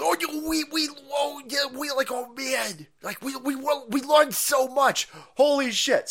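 a flustered official babbling; mock Fauci. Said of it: "he goes".

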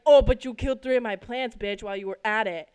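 loud sarcastic angry retort; fuming. Retold or imitated. Daughter snapping back at dad over killed plants, fuming. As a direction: fire it back loudly, reenacting the fury. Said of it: "when I tell you I was fuming".

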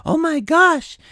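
high, slow, mock-adoring gush; gushing fan. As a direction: swoon over him like a devoted fan. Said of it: "there's still people saying".

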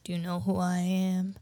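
gunman character. Acted. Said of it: "he shows up and he's like".

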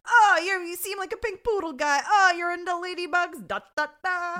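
high, loud hammed-up patter; balloon animal entertainer. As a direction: play an over-eager balloon twister, singsong and loud. Impersonating the balloon animal guy.

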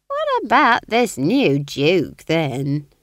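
change the subject breezily, drawing it out; lightening the mood. A narrator's reading. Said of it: "to lighten the atmosphere".

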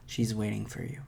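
sultry breathy whisper. Pretend. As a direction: breathe it like a seduction. Sexy whisper bit continues.

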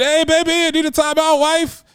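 mock broadcaster excitement, pitched high and loud; Dick Vitale voice. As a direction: bellow it like a college hoops announcer at home. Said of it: "he's at home saying".

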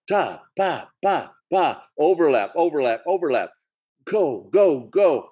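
excited imitation of fast banter; rapid-fire demo. Shown as a demonstration. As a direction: mimic the rapid overlapping patter. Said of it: "they were just so fast-paced".

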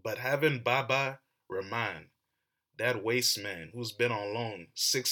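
usual speaking voice

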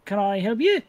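high, polite little greeting; gnome shopkeeper voice. Acted. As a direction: greet the customer like a small, prim gnome. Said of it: "you just see this individual say".

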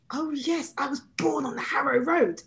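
high-pitched, playfully obliging answer; mock-innocent reply. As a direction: answer brightly as if missing the point. Acted reply to nosy colleagues.

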